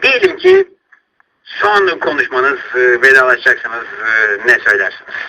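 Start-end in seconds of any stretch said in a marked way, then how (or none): 1.4-5.1 alçak, yavaş, yumuşak sesle sor; kendi sesinden çok daha pes ve yavaş. Real, not acted. Duygusal soru hazırlığı. (dinleyiciye vedayı yumuşak sesle soruyor)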